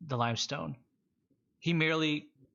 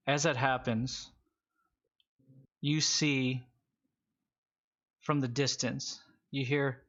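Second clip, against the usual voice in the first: normal talking voice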